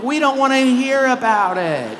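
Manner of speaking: high, loud, laughing groan of protest; mock fed-up outburst. Friends groaning at her cat worm stories, loud and laughing. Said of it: "And we'd be like".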